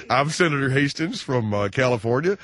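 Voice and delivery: senator's put-on American accent, edged with laughter. Shown as a demonstration. Senator arriving at London restaurant, laughing put-on American accent. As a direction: affect a senator's accent while amused. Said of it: "affect any kind of an accent".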